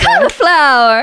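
grand announcement. Acted. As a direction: announce it like a winner. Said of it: "Do the thing".

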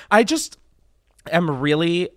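talking while choking on saliva; words caught on swallowed spit. Real, not acted. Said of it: "I'm choking on my saliva".

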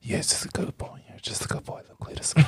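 put-on soft whispered baby talk; whispering to a pet. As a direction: coo softly to the animal. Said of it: "whisper sweet nothings".

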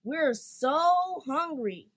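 slow, loud, high hungry wail; crying out. A narrator's reading. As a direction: whine loudly for food. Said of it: "the crutches cried out".

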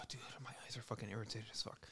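strained whisper through irritation. Real, not acted. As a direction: whisper it, eyes stinging. Eyes burning from smelling salts.

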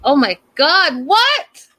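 high, loud "what" shooting up; can't believe what she just heard; shocked disbelief. Real, not acted. Recapper reacting to the fiance's words, shocked and shrill.

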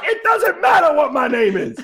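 high, slow, laughing put-on quote voice; The Rock quote. [name] quoting The Rock at phone callers, laughing. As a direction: lightly mimic The Rock with a laugh. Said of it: "to quote The Rock".

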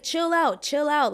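urgent calming. Retold or imitated. Rev calms the girls.

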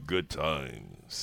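booming PA announcer, deep. Pretend. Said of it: "do it from the voice of God".

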